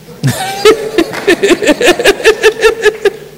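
high, loud burst of laughter; hearty laugh. Real, not acted. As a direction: let out a big laugh. Laughs at his own story.

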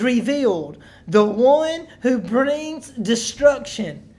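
loud, slow, weighty Bible reading; preacher spelling out a solemn prophecy; emphatic scripture reading. Acted. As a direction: proclaim each phrase deliberately to a congregation. Reads scripture aloud emphatically to congregation.

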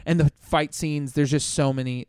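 ordinary delivery

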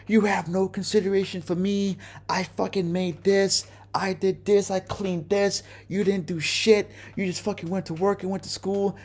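a hint of her screaming accusations; mild mock scream. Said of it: "screaming at the top of her lungs".

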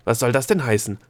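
startled, indignant questioning; irritated astonishment. A narrator's reading. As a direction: react loudly, baffled and put out.

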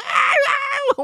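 very high, loud imitated wail; charging child's scream. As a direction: shriek like a kid charging. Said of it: "孩子用冲的". (模仿孩子边冲边尖叫)

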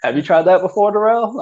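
high, playful mock nudge; teasing high voice.

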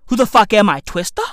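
normal talking voice

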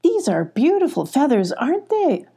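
high, lilting delight in a question; admiring wonder. A narrator's reading. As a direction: marvel brightly, voice rising to the end. Child character admiring in story.

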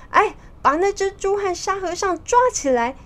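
high-pitched villain's command; monster giving orders. Acted. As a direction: call out a sudden order to the minions. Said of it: "妖精突然下令说". (妖精高声下令抓人)

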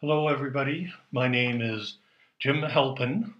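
ordinary talk, nothing marked

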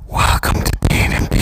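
breathy seductive whisper; sexy voice. Pretend. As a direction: purr it out low and seductive. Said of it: "I'ma say it in a very sexy voice".

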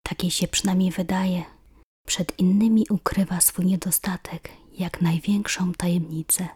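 usual speaking voice

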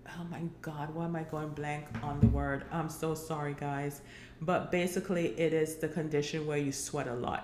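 ordinary talk

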